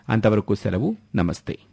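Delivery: plain reading voice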